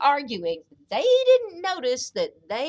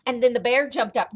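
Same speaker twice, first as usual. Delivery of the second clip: ordinary delivery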